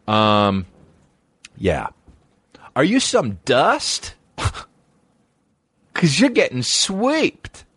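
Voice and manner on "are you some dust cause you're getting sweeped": high, slow put-on janitor voice taunting; mock janitor. Comedian playing a janitor sweeping up a fallen fool, high slow mock voice. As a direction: drawl it out like a smug janitor sweeping someone up. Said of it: "I'm a janitor".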